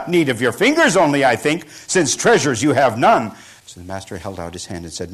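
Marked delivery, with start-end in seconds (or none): 0.1-3.4 loud mockery. Robber mocking the monk.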